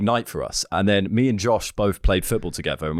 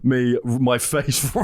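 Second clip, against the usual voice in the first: ordinary talk, nothing marked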